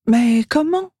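high, small questioning voice; little elf asking. A narrator's reading. Elf asking how to escape, high questioning voice. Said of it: "demande Lise".

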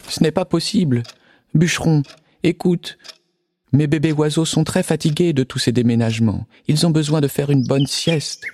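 worried little bird voice. Acted. Mother bird voice.

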